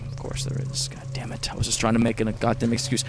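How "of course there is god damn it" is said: whispered, under the breath; whispered curse. Real, not acted.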